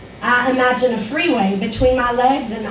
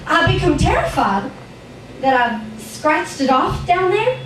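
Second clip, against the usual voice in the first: put-on frightened little child narrator; 2 semitones higher than usual. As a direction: tell it as a worried five-year-old.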